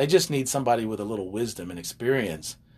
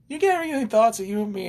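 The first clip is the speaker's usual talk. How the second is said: Southern accent, higher, imitating his wife; 11 semitones higher than usual. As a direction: do her Southern accent, pitched up. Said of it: "now you have to do this".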